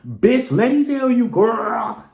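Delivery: put-on loud, high sassy girlfriend voice; exaggerated sassy girl talk after hanging up; off-the-phone sass. Shown as a demonstration. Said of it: "very much all that".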